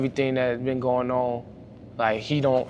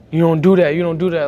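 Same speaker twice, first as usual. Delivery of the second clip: loud, raised scolding voice; coach scolding; 4 semitones higher, 9 dB louder than usual. Retold or imitated. Coach scolding his player on the sideline, loud and raised. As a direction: snap the warning at him.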